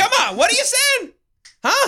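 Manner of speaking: very high, hammed-up heckling; exaggerated high-pitched demand; mock badgering. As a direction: pester them in a silly high yell. Joins the mock hectoring bit.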